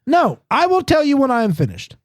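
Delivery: high-pitched indignant customer; indignant retort. Acted. Customer to drive-thru worker, indignant. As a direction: snap back in a high, fed-up voice.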